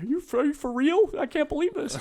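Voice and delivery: amazed delight. Retold or imitated. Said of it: "And she's like".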